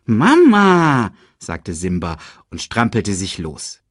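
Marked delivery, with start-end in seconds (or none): 0.1-1.1 put-on high, loud, drawn-out child's whine; protesting cub. A narrator's reading. Cub wriggles free from mother's licking, high protest.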